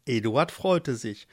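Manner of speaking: ordinary delivery, nothing marked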